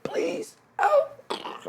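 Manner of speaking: very high-pitched pleading cry; thin, high cry of captives; pleading victims. Acted. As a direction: beg for help while being dragged off. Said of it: "you can hear the Guardians like".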